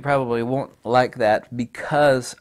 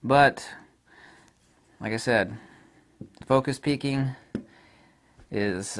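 usual speaking voice

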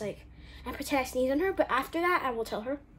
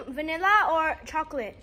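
ordinary delivery

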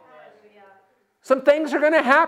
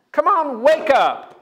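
usual speaking voice

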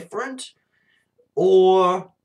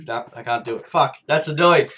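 usual speaking voice

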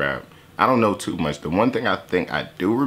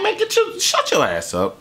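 mock dismissive retort; 10 semitones higher than usual. Retorting to commenter.

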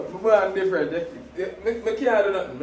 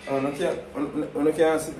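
ordinary talk, nothing marked

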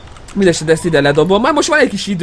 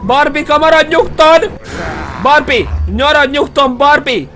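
calling out; 7 semitones higher than usual. Pretend. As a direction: shout at the enemy to stay put. Calling to the chasing enemy.

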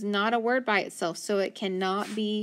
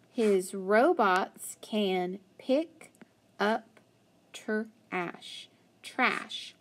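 slow read-aloud; much slower than usual. Acted. Reading story aloud slowly.

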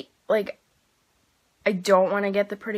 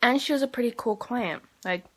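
talking while chewing; 3 semitones higher than usual. Real, not acted. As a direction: mouth partly full. Seen 0: Chews after bites of a chicken wing; licks finger at 3.8.

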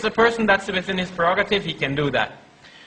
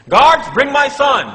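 higher, louder, slow judge's command to the guards; 6 semitones higher, 6 dB louder, slower than usual. Acted. Judge orders guards to bring his son, loud slow command.

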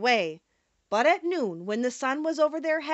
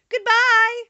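high, loud farewell call; 11 semitones higher, 10 dB louder than usual. A narrator's reading. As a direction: call goodbye in a high mouse-queen voice. Mouse queen calls out farewell.

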